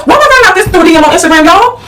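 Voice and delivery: high-pitched exasperated complaint; mock cussing out. Daughter cussing out mama over Instagram news, exasperated. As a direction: retell the outrage, rising high into disbelief. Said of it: "I had to call, cuss out my mama".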